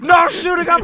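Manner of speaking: loud mock yell, high; shout. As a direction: yell through the door. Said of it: "and he's like".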